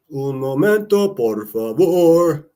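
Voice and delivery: drawn-out hammy Spanish; playful Spanish. Pretend. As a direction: stretch it out like a waiter asking you to wait. Spanish phrase while making viewers wait.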